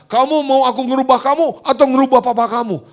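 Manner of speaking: high, loud booming question; voice of God. Retold or imitated. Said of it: "Tuhan bilang".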